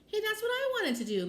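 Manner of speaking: high-pitched protest called across a room; calling out. A narrator's reading. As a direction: call out like an indignant kid. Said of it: "Max called from the chair".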